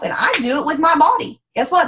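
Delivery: normal talking voice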